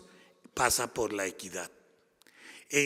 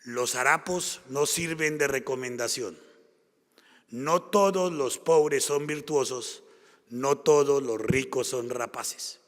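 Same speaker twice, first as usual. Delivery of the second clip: slow, weighty reading of a favourite quote; emphatic quotation; 4 dB louder than usual. Shown as a demonstration. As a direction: lean into each phrase and savour it. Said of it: "tiene una frase que a mí me encanta".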